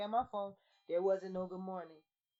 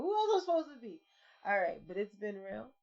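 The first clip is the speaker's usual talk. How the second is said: ordinary talk, nothing marked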